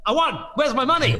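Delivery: high, loud mock protest; worked-up retelling, pitch way up; indignant demand. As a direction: stop him and demand what you're owed. Retold demand for winnings.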